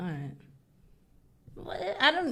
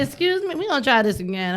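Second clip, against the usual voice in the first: high, loud scolding grandma; stern grandma voice; 5 semitones higher, 7 dB louder than usual. Retold or imitated. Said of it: "she be like".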